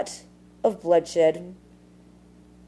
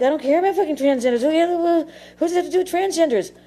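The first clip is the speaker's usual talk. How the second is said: exasperated outburst; 9 semitones higher, faster than usual. Retold or imitated. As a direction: fire it back in disbelief, pitch climbing. Retelling her outburst at Google.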